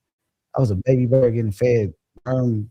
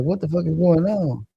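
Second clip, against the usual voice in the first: put-on higher, confused baby-bird panic; retold panic; 7 semitones higher than usual. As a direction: blurt it out, lost and scared. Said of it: "and I'm like".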